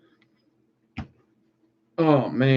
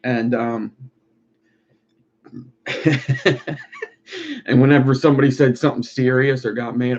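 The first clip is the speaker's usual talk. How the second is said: ordinary talk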